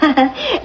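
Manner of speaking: a small awkward giggle; short embarrassed laugh. Real, not acted. The mistress, embarrassed that the references are in French, gives a short giggle. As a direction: laugh it off, a bit caught out.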